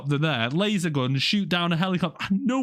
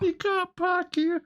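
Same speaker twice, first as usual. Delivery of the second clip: mock security guard; slower than usual. Mocking the lax security guard.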